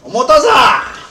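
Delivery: Omotaza shouted high and loud as mock Japanese; fake foreign language. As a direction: yell Omotaza like a made-up Japanese battle cry. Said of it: "that's not Japanese. It's just sounds".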